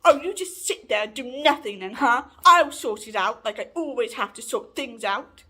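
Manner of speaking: loud, huffy imitation of a rant; retold angry outburst. Housemate angry over the lost internet, imitated loudly. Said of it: "she got pretty angry at me".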